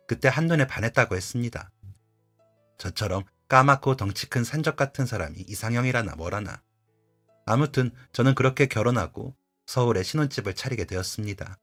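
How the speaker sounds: plain reading voice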